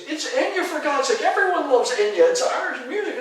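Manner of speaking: very high, loud mock pleading; frantic protest. Time traveler defending [name] to witch-burners, frantic mock protest. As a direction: protest in hammed-up exasperation.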